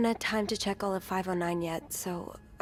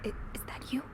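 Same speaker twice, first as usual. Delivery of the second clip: soft, uneasy near-whisper; nervous hush; 4 semitones higher, 7 dB quieter, much faster than usual. Acted. Sophie, uneasy on a silent call, asks softly and nervously. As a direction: ask it warily, barely voiced.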